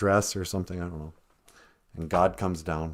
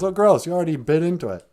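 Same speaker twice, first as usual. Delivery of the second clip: quick, high, loud protest; mock disgust; 8 semitones higher, 5 dB louder, faster than usual. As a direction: act grossed out. Adam recoils from bitten apple.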